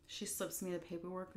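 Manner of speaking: usual speaking voice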